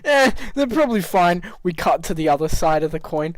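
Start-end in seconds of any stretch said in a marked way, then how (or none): 0.0-1.4 higher, lighter echo of the line; mocking repeat. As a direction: toss it back in a higher voice.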